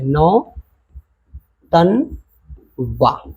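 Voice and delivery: Nautanwa very slowly as नौ, तन, वा, with long pauses; broken into syllables. Shown as a demonstration. Teacher writing out the word for pupils, slow syllable by syllable. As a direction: stretch Nautanwa out in three separate pieces. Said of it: "इसको ध्वनि के base पे तोड़ो".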